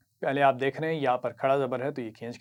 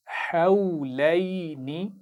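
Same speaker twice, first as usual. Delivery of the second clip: drawn-out, melodic tajweed recitation; slow Quran recitation; 2 semitones higher, much slower than usual. Shown as a demonstration. Teacher reciting slowly for pupils to repeat. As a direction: chant each syllable slowly for the class to copy. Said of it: "गौर से सुनिए और फिर दोहराइए".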